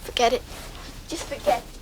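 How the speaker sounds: dropping to a dejected murmur; hurt and quiet. Acted. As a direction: give up, voice sinking away. Giving up, hurt.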